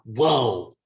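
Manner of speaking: a stunned, wondering whoa; awed whoa. Retold or imitated. Singer recalling his teacher's words, stunned awe. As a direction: let the idea hit you. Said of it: "And I thought".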